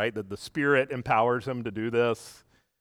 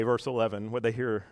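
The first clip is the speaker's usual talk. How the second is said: usual speaking voice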